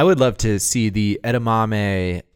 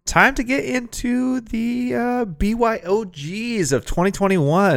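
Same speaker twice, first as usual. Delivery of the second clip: high, slow show-host intro; announcer voice; 12 semitones higher than usual. Pretend. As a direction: announce it like a segment opener. Announcing the next segment.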